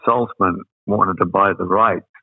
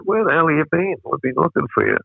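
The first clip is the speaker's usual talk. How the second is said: higher-pitched retold exclamation; lightly exasperated, pitched up at the start; mates' mock indignation; 7 semitones higher than usual. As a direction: call out a friend who vanished. Mates greet him after long absence.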